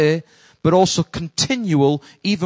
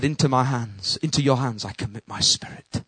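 soft surrender; 7 semitones lower, 9 dB quieter than usual. Retold or imitated. He shouted aloud.

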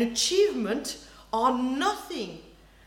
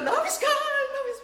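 mock swooning; 14 semitones higher, 3 dB louder than usual. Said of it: "That's what you".

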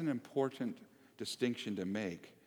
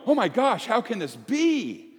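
amazed crowd; 11 semitones higher, 10 dB louder than usual. Retold or imitated. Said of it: "others that were going".

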